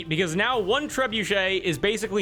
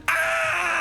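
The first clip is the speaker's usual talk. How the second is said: very high short screech; blinded scream. Acted. Bows blinded by sunlight, high screech. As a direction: shriek as the light hits. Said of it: "as they both go".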